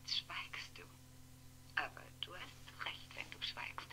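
soft whispered reproach; hurt whisper. Acted. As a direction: whisper it as a wounded aside. Hurt by his silence.

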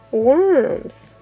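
plain reading voice

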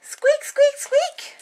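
very high squeaks; mouse squeaking. Acted. Mouse answering the cat, very high squeaks.

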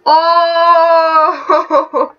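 long, high, loud "oh" breaking into a laugh; loud drawn-out squeal; excited wail. Real, not acted. Player reacts to kiss revelation.

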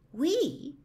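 a high, startled "We?"; surprised exclaim. Acted. Dorothy startled that Oz will come along, high surprised. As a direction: catch the word in surprise. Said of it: "exclaimed the girl".

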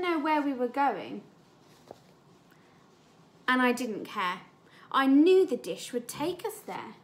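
lively storybook read-aloud for a class; story reading. Acted. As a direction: read it like a picture book to children. Teacher reads story aloud.

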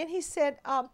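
ordinary talk

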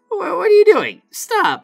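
very high startled yelp; shocked outburst. Real, not acted. Worker shocked at the customer's request, very high. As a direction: recoil in disbelief.

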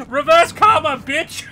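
high, loud taunting yell; triumphant shout. Real, not acted. Streamer taunting Dougie boy, loud gleeful shout. Gloating at rival.

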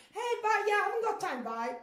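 acted busy passer-by; hurried brush-off. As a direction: call out a goodbye without stopping. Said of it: "Otherwise, if you haven't got time".

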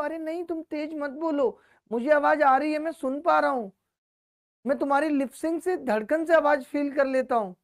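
high-pitched imitation of a boyfriend; mock doting boyfriend. Teacher mocking student's late-night phone reassurance to girlfriend, high mock-boyfriend voice. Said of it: "तो तुम कहते हो".

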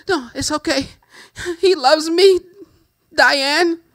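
lovesick defensive woman. Acted. Abused woman defending her husband.

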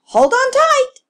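high, loud urgent call; mother calling out a warning to hold on. A narrator's reading. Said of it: "Echo's mother called to him".

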